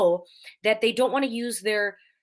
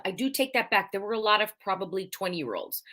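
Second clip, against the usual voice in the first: ordinary talk, nothing marked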